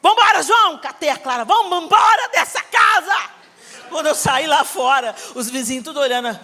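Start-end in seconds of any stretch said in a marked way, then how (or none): 0.0-0.8 higher, louder angry command; grito pra ir embora. Retold or imitated. Saindo brava da casa. 1.4-3.3 higher, louder, slow furious shout; grito pra ir embora. Retold or imitated. Saindo brava da casa.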